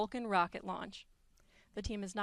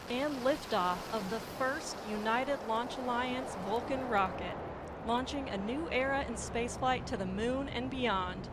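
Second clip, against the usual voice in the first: excited; 3 semitones higher, 3 dB louder than usual. Real, not acted. Host calling the liftoff live.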